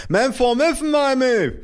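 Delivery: very high, muffled lisping outburst; mother bellowing. A narrator's reading. Mother losing patience with her child, high muffled yell. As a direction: cry out high through a swollen mouth. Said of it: "brüllte meine Mutter".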